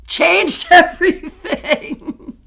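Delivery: high, drawn-out words through laughter; laughing. Real, not acted. Joking about marrying a morning person, laughing. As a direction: stretch it out while laughing. Laughing at her own joke.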